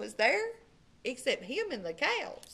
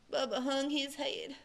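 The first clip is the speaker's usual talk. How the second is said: plain reading voice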